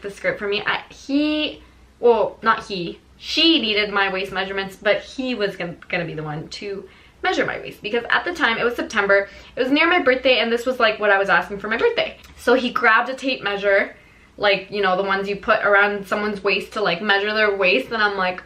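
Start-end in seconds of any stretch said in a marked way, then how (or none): none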